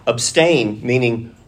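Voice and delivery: usual speaking voice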